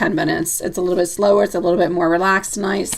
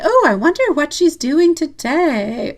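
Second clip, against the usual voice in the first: higher, slower put-on voice of a wondering man; acting as him; 8 semitones higher, slower than usual. Reader voices the curious man wondering about the caller, high and slow. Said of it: "that's me acting as I'm him. ... doing that voice".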